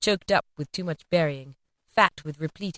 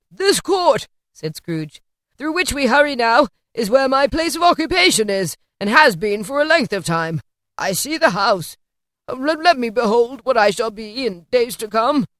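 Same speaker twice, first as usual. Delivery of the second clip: put-on higher, louder anxious old man; old man eager to see his future; lightly voiced Scrooge; 4 semitones higher, 6 dB louder than usual. A narrator's reading. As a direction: Scrooge pleading with the spirit.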